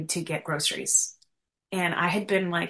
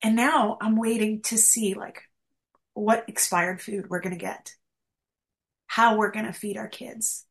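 angry retold prayer; 4 semitones higher than usual. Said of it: "And I was just angry".

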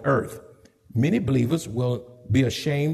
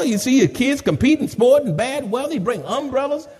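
ordinary delivery

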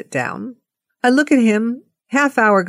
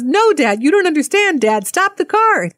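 put-on high, urgent kid's protest; mock childish pleading, pitched way up; insistent child; 10 semitones higher than usual. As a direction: imitate a daughter begging her dad. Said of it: "She goes".

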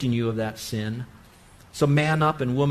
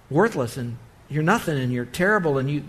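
ordinary talk, nothing marked

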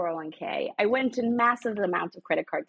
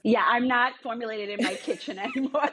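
amused, breaking into a laugh; 3 semitones higher than usual. Real, not acted. Guest jokes to host about outgrowing her kitchen, laughing. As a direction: say it through a real laugh.

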